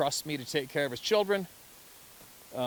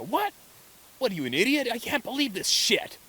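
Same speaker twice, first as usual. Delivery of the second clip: high, loud indignant outburst; mock outrage; 12 semitones higher, 6 dB louder than usual. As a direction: blow up in disbelief, hammed-up. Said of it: "people out there that'll get angry".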